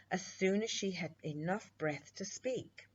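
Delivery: usual speaking voice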